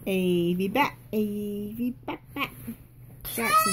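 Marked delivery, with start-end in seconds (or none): 3.2-3.7 calling out. Real, not acted. Calling out to child.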